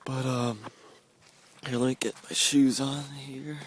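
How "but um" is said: ordinary talk, nothing marked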